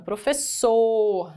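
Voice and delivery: "Professor" slowed, with a long final "sor"; stress on last syllable. Shown as a demonstration. Teacher showing the word is oxytone, final "sor" drawn out in "Professor". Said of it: "A última, ela é oxítona".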